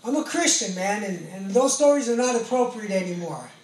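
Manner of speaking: loud, high insistence; earnest declaration. Retold or imitated. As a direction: proclaim the new faith to the guys. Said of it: "I said".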